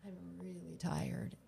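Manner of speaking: a hushed, broken whisper; whispering her worn-out truth to a friend; whispered confession. Retold or imitated. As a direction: whisper it like you're finally admitting it. Retold confession on the front steps.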